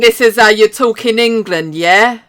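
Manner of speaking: loud, drawn-out fake Cockney; mock Cockney accent. Mocking Americans doing British accents, loud bad Cockney. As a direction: overdo Americans attempting Cockney. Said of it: "like a Cockney accent?".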